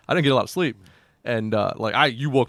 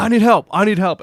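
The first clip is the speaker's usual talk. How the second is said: much louder, higher, breathless shout for help; mock out-of-breath yell; 8 semitones higher, 8 dB louder than usual. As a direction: yell it like you just ran five hundred yards. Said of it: "Like, out of breath".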